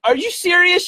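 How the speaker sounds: very high, loud squeal; thrilled disbelief. Retold or imitated. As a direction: shriek it with delight. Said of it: "she says".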